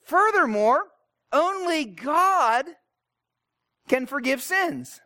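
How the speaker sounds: high, slow, pompous objection; religious teachers. Retold or imitated. Religious teachers lecturing Jesus, pompously indignant. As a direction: draw it out like an indignant official laying down doctrine. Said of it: "And then they say".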